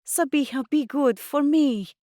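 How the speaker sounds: high, insistent pleading; urging plea. A narrator's reading. Mum asking daughter to be good, urging. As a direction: urge her to comply. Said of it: "Mum urged".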